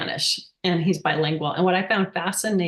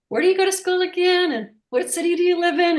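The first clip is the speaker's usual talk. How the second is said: voicing dad; 10 semitones higher, 3 dB louder, faster than usual. Dad asking the same questions.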